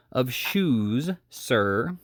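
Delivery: "shoes" drawn out with weight; emphasis on SHOES. A narrator's reading. Giles correcting the tinker who interrupted him, emphatic on "shoes". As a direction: lean firmly on "shoes". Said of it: "laying great emphasis on the word".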